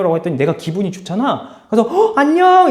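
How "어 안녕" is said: high, loud delighted hello; teacher retelling a pleased hello to a student; cheerful greeting. Retold or imitated. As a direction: greet a student you are glad to see.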